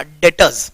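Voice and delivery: "डेटर्स" said as "det-uhz", R hardly sounded; light R. Shown as a demonstration. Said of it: "आर को भी हल्का pronounce करके".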